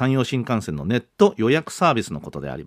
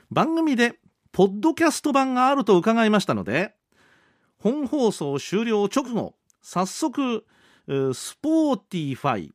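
higher-pitched, deliberate radio read-aloud; reading listener mail; 8 semitones higher, slower than usual. Acted. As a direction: read the letter out in a lifted presenter voice.

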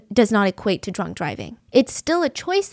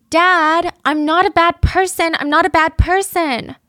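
imitating a whiny, higher-pitched plea; mock whine, pitched up and pleading; whining; 7 semitones higher, 3 dB louder than usual. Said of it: "she also whines".

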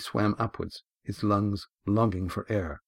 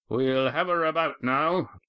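lightly voiced stern old skipper; a hint of a weathered sea captain; gruff skipper; 6 semitones higher, slower than usual. A narrator's reading. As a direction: give the order like a hard-bitten captain. Skipper announces the turn.

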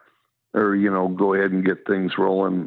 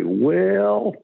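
high, stretched two-syllable Southern "Well"; Southern drawl; 10 semitones higher than usual. Shown as a demonstration. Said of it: "they'll say".

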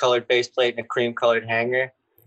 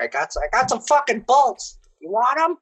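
much higher, pissed-off skater voice; Dennis Busenitz voice; 10 semitones higher than usual. Retold or imitated. Skater imitates his buddy offering bolts, much higher and angry. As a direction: mimic your buddy sounding mad, way up high. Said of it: "That's how he sounds".

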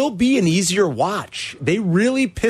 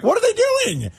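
high-pitched mock rant; hammed-up fan disbelief; an imitated outraged fan. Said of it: "and be like".

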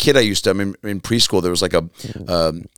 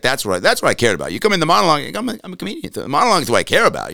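higher voice, lightly voiced Leno; imitating Leno on the monologue; Leno impression; 7 semitones higher, faster than usual. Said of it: "he's like".

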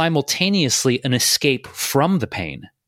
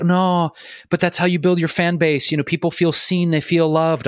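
higher, insistent mock objection; objecting people; 6 semitones higher than usual. Host mimics people defending fan messages, higher-pitched objection. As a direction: protest in a raised imitation voice. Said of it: "Some people are like".

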